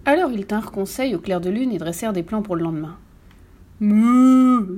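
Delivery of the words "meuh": long, loud moo; cow moo. A narrator's reading. Cow at the animals' secret council, loud drawn-out moo. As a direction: moo it out, sustained. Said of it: "dit la vache".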